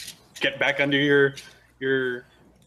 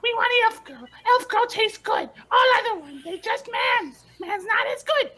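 very high, yelling hungry goblin; high goblin screech demanding to eat. Acted. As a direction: squeal it like a greedy little goblin.

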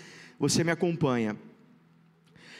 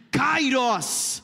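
shouted, ringing prophetic call; 4 semitones higher than usual. Shown as a demonstration. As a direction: proclaim it like a prophet. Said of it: "Diga comigo com voz de profeta".

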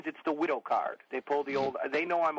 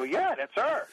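wry admission; 10 semitones higher, slower than usual. Retold or imitated. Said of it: "And I go".

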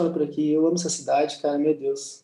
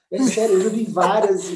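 usual speaking voice

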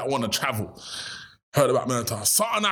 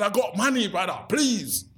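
begging; 9 semitones higher than usual. Retold or imitated. Begging the factory owner.

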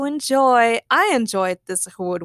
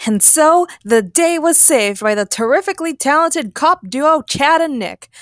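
loud, high TV reporter voice; raised, projected reporter delivery; news announcer; 6 semitones higher, 6 dB louder than usual. Acted. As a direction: announce it like a triumphant newscast. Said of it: "the news reporter announced".

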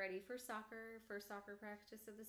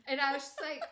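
usual speaking voice

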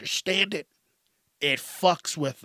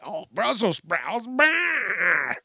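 mock disgust; 6 semitones higher, much slower than usual. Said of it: "You hear people like".

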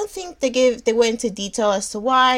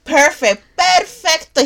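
emphatic approval; 7 semitones higher, 8 dB louder, slower than usual. Real, not acted. Praises the Swede's pronunciation of name.

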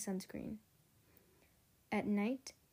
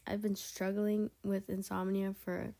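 ordinary delivery

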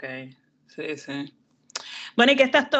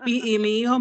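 normal talking voice

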